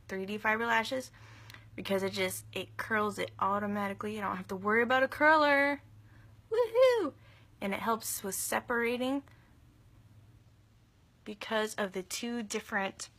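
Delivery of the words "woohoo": excited cheer. Real, not acted. Thrilled by self-curling mascara.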